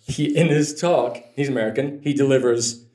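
ordinary talk, nothing marked

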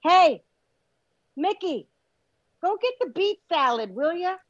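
hammed-up bright order to a friend; cheery pretend request. Exhausted person asking Mickey for salad, mock-chirpy. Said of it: "wakes up after getting two hours of sleep and says".

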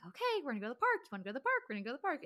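high, bouncy, squeaky dog-walk voice; excited dog voice. Shown as a demonstration. Owner hyping up Smudge for a park trip, high and squeaky. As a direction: pump the dog up about the park. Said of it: "high, higher pitched little, like, poppy, squeaky sounds".

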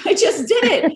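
giggling it out, amused at herself; laughing. Real, not acted. Laughing as she tells the host she just went live.

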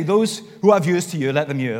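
put-on joking Welsh accent, "ears" and "hear" as "yurs" and "yur". Preacher jokes how Jesus says it in Wales, Welsh accent. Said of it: "That's what he says in Wales".

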